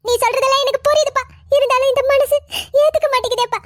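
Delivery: sobbing, despairing plea; tearful. Acted. Mother crying out to God over their troubles, tearful. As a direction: weep it out, breaking into sobs. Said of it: "அழுது உடம்ப கெடுத்துக்காதீங்க".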